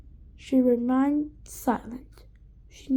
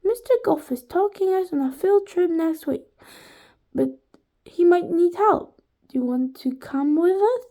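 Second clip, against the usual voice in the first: higher-pitched, lightly voiced schoolgirl; Allison; 6 semitones higher, 4 dB louder than usual. A narrator's reading. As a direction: lightly put on a pupil's higher voice. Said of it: "this time using Allison's voice".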